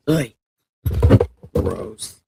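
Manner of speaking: low, grossed-out groan of disgust; disgusted ugh. Real, not acted. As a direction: recoil from a bad taste. Tasting coffee she finds gross.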